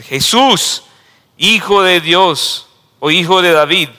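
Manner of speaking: loud pleading cry; high, loud plea for mercy. Acted. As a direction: call out desperately to Jesus. Blind man cries out to Jesus.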